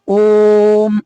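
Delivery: "ओम" with the ओ held long and firm; "ओम" sounded with a long, loud ओ; prolonged om. Shown as a demonstration. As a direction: draw out the ओ of "ओम" like a chant. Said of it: "ओ को जोर दे करके बोलते हैं".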